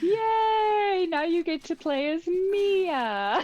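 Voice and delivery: high, loud, drawn-out cheer; excited cheer. Real, not acted. Guest telling the player he gets Mia now, excited cheer. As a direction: celebrate with stretched-out words. Cheering the game's character switch.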